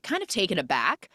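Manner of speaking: ordinary delivery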